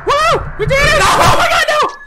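high, loud whooping cheer; triumphant cheer. Real, not acted. Gamer celebrating a win, whooping and laughing.

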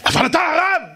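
high-pitched outraged yell; shouting neighbour. Retold or imitated. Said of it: "אבל למה אתה צועק". (צעקת מחאה גבוהה של שכן)

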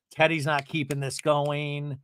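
normal talking voice

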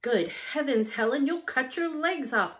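high, loud cry of alarm; agitated. A narrator's reading. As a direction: call out in agitation to stop an accident. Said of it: "called her father in an agitated tone".

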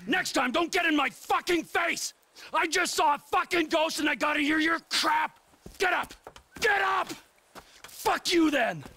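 furious yelling. Acted. Rage continues.

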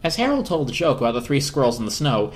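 ordinary delivery

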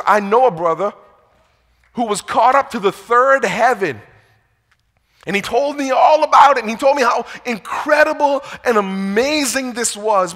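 loud, high, building amazement; excited retelling. Retold or imitated. Paul tells of a brother caught up to heaven, excited retelling. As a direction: voice Paul marvelling, swelling with wonder.

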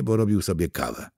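ordinary delivery, nothing marked

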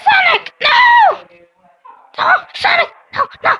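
high, loud pleading no; despairing shout. Real, not acted. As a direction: cry out to save Sonic. Sonic is eliminated.

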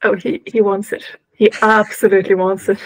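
ordinary talk, nothing marked

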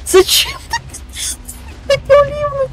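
squealing very high; high-pitched whine. Real, not acted. Squeals as he is killed. (визгливое нытьё)

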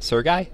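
SERGEY ending like "guy", questioning; alternative pronunciation. Shown as a demonstration. Testing a target's name aloud, Sergey as "sur-GUY"?. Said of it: "Is that how I say it?".

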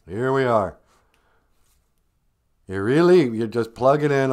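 normal talking voice

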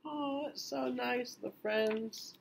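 ordinary delivery

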